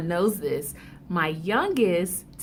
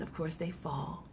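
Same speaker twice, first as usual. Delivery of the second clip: ordinary delivery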